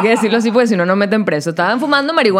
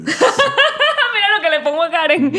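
high, drawn-out words through real laughing; helpless laughter; 11 semitones higher, slower than usual. Real, not acted. Guest sharing an inside joke about Karen, laughing hard. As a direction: crack up over an inside joke, barely talking. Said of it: "esto es risa interna horrible".

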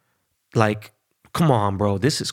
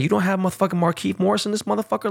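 outraged; 9 semitones higher, faster than usual. Retold or imitated. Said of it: "And it's like".

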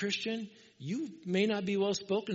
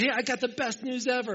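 higher, eager announcement; eager news; 7 semitones higher, 4 dB louder than usual. Retold or imitated. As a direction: burst out with the good news. Soldier sharing his news.

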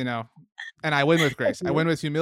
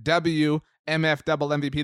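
normal talking voice

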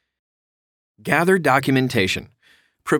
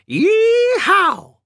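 high, loud playful cowboy whoop; a hammed-up Southern yeehaw, much higher; 5 dB louder than usual. Joking with viewers after slipping into an accent, playful cowboy whoop.

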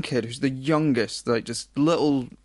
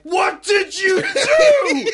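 much higher, louder, slow furious scream; imitated screaming; 7 dB louder, much slower than usual. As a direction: shriek it at the kids like a raging parent. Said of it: "there was screaming".

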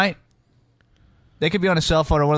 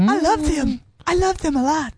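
high gushing voice; 11 semitones higher than usual. Pretend.

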